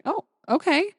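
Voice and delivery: a light caught-off-guard okay; lifted okay, gently startled; mildly surprised. Retold or imitated. Traveller surprised by the eager clerk, lightly startled. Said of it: "And I was like".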